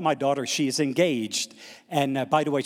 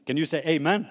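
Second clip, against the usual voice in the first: usual speaking voice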